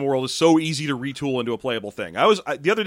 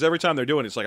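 ordinary talk

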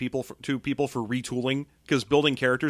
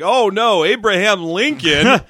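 loud high slow mock alarm; mock panicked cry; 6 semitones higher, 9 dB louder, much slower than usual. Improv trainee shouting in mock shock at the customer. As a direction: ham up sudden horror at the old lady. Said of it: "And you're like".

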